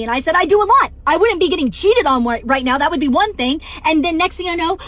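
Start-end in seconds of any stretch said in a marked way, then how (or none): 0.3-3.6 indignant retort. Retold or imitated. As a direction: fire back at him, offended. Arguing with cheating husband.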